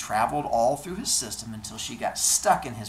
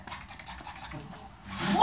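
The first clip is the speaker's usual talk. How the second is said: running footsteps noise; slower than usual. Retold or imitated. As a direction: mouth the footsteps without voice. Imitated running feet.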